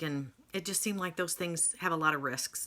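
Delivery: ordinary talk, nothing marked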